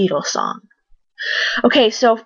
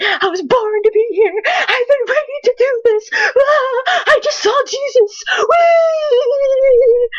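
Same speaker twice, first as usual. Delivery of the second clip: imitating the overexcited singer; slower than usual. Vlogger mimics the singer freaking out on stage, teary high shrieking. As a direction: ham up a tearful, ecstatic outburst. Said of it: "She was like".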